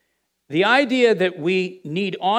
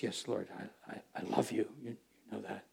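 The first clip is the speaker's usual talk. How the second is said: low, very soft, halting answer; subdued, hesitant Peter; 9 semitones lower, 18 dB quieter than usual. Acted. Peter answers Jesus's repeated question, subdued and hesitant. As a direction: answer haltingly, much lower and quieter.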